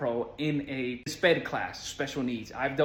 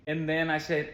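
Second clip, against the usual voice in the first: ordinary delivery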